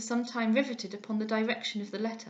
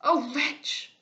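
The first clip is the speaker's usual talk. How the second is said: louder self-reproach; father cursing himself, louder; anguished cry; 4 semitones higher, 5 dB louder than usual. A narrator's reading. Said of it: "cried he".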